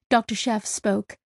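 ordinary delivery

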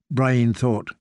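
ordinary delivery

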